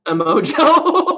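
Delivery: drawn out through real laughter; laughing. Real, not acted. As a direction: laugh it out slowly, cracking up. Laughing while reading chat name.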